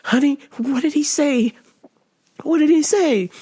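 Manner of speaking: lightly voiced pleading mother; imitating worried mom. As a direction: play a wife anxiously asking. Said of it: "And the mom goes".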